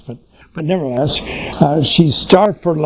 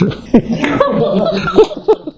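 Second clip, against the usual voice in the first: amused laugh. Real, not acted. Laughs at daughter's comeback.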